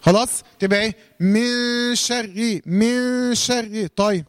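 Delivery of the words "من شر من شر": very slow, long nasal hum on the ن of "من" before "شر"; ikhfa with ghunna. Shown as a demonstration. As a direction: hide the ن of "من" in a held nasal hum. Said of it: "والإخفاء ده معاه غنة". (إخفاء نون "من" بغنة ممدودة قبل "شر")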